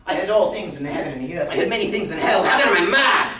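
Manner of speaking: rising to a high, wild shout; madman's outburst, pitch climbing; frenzied protest. Acted. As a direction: build to a loud, manic challenge. Madman indignantly protests his sanity.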